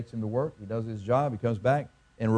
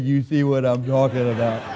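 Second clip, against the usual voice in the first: louder, with laughter; a louder, laughing tag line; laughing punchline; 4 dB louder than usual. Retold or imitated. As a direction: deliver the punchline through a laugh.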